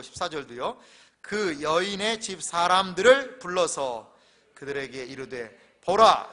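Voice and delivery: loud, slow, declaimed verse; scripture reading. Acted. Pastor reading Joseph's false accusation to the congregation, loud solemn declamation. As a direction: proclaim each phrase with weight, stretched out.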